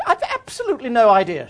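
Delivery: loud, high, brisk dismissal; booming countrywoman impression; English countrywoman. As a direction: play a formidable country lady, emphatic and indignant. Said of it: "archetypal English countrywoman".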